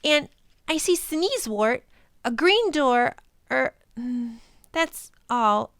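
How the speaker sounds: put-on a child reciting what she sees; young girl voice.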